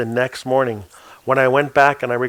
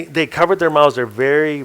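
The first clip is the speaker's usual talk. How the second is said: ordinary delivery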